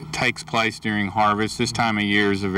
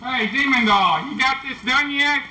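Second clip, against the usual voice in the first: drill sergeant; 12 semitones higher than usual. Acted. Winery guide barking at recruit.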